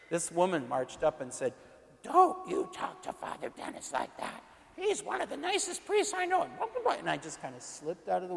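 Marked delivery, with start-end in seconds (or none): 2.0-6.5 high, scolding church-lady defence; indignant lady voice. Retold or imitated. Woman scolding the accuser to defend Father Dennis, high indignant voice. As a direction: snap at her to defend the priest.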